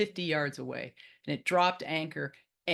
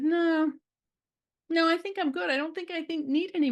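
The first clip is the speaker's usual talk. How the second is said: high-pitched polite refusal; 11 semitones higher than usual. Acted. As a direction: decline in a comically high voice. Teller declines more turtle things.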